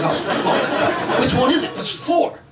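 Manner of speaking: high, panicked squeak; flustered panic at the forks; panicked surprise. Retold or imitated.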